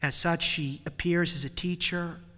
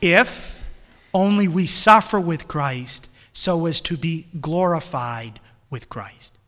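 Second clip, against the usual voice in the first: loud, impassioned sermon; fervent preaching; 4 semitones higher, 12 dB louder, slower than usual. Acted. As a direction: swell into a ringing exhortation. Preaching with force.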